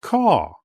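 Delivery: put-on a bird's caw in a child's story; crow caw. A narrator's reading. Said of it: "I make this sound".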